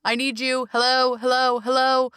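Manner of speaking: put-on high, insistent children's chorus; needy kids calling. Twenty-six kids clamoring at their parent, high and insistent. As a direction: call for attention like clamoring kids. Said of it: "twenty-six kids are like".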